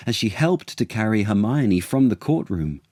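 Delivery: ordinary delivery, nothing marked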